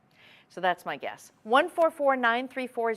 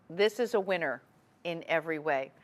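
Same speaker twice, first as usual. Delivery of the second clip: ordinary delivery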